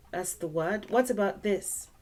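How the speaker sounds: normal talking voice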